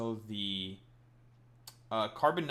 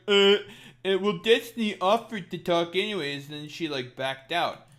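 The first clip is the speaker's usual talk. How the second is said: mocking whiny excuse; 6 semitones higher, 9 dB louder than usual. Mocking chat users' excuses.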